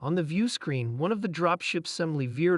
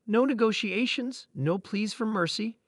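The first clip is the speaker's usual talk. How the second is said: ordinary delivery, nothing marked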